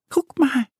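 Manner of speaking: high little duck voice, excited; Annie calling out. Acted. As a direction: call it out like a small excited duckling. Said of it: "ruft Annie".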